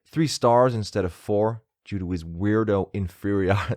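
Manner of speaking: put-on American accent. British host reading out a bad review, mock American accent. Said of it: "I'm gonna read this in an American accent".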